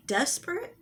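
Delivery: "desperate" swooping up high as a question; high, rising, appalled "desperate"; incredulous echo. Real, not acted. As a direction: throw "desperate" back in disbelief, sharply rising. Disbelieving echo of his excuse.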